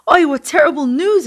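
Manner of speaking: high, loud, shocked cry; dismayed exclamation. A narrator's reading. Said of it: "exclaimed the Rav".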